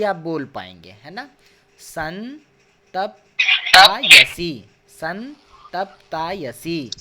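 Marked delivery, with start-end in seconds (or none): 1.8-6.9 "संतप्तायसी" very slow in chunks, the first take loud; chunked slow practice. Shown as a demonstration. Teacher drilling a word for the class, very slow. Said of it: "एक बार में बोलिए और फिर तायसी".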